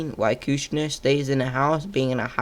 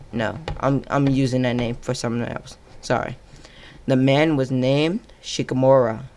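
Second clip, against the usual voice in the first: ordinary delivery, nothing marked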